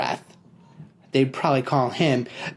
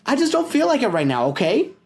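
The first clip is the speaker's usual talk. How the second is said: high and fast, brushing off; defensive snap; 10 semitones higher, faster than usual. Acted. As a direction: get him off your back. Peter snaps defensively.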